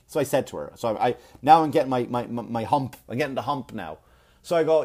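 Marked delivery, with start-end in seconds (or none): none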